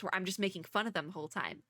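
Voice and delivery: ordinary talk, nothing marked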